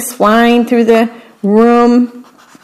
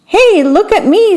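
high, excited squeal; 10 semitones higher than usual. A narrator's reading. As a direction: squeal it with glee. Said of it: "Squeak squealed".

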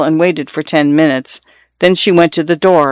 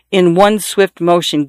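usual speaking voice

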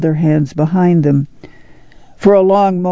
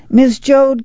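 higher call across the boxcar; 9 semitones higher than usual. A narrator's reading. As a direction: call to her from the far end. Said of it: "came the call".

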